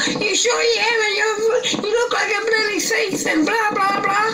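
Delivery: woman turning on the helper, high crying shout; shrill woman accusing. Retold or imitated. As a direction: screech it like the hysterical partner. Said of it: "she is attacking me as well".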